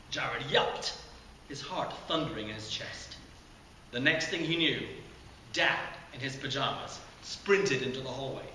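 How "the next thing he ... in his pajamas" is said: plain reading voice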